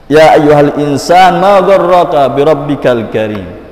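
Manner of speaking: verse declaimed to the congregation; Quranic recitation. Acted. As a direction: recite loudly with drawn-out opening, falling at the end.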